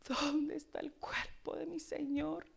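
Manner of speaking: rising, pleading grief; mild mourning cry; voiced lament. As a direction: say it aloud now, searching. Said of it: "Lloraba, hermanos".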